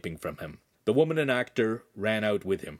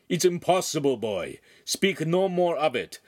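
higher, firm dismissal; stern order; 6 semitones higher, 3 dB louder than usual. A narrator's reading. Master Cagliari orders the boy to stop, stern. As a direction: shut the boy down. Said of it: "Master Cagliari ordered".

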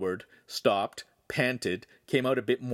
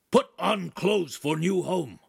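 higher, louder choppy dog bark; high staccato bark; 8 semitones higher, 4 dB louder than usual. Acted. Dogface ordering Handsome to change, staccato bark. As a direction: bite off each word. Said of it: "in a high staccato bark".

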